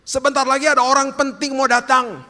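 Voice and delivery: high, loud crier's call; herald's shout. Acted. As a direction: holler a royal arrival to the whole town. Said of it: "dia teriak".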